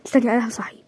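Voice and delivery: whispered, low. Acted. Offering to wake the boy, whispered. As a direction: hush it out.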